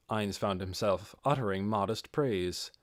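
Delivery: plain reading voice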